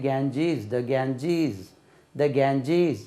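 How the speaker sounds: long ee in the second syllable of "கேஞ்சீஸ்"; English pronunciation. Shown as a demonstration. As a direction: model "Ganges" softly with a z ending. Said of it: "ஆங்கிலத்துல உச்சரிக்கும் போது".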